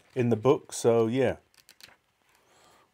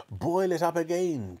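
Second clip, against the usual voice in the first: excited cry; 8 semitones higher than usual. A narrator's reading. Mister Cranky urging George at the saucepan, excited cry. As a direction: cry the order out eagerly. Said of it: "cried Mister Cranky".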